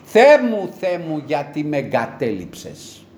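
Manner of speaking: ordinary talk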